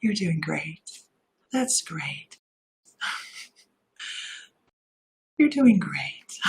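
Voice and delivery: soft, slow hypnotist murmur, drifting; hypnosis voice. Shown as a demonstration. Showing viewers her hypnosis voice, soft and slow. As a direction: lull them under like a hypnotist. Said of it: "You should hear my hypnosis voice".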